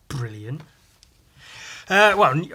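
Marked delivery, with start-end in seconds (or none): 0.1-0.6 deadpan sarcasm. Real, not acted. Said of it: "I don't mean actually brilliant".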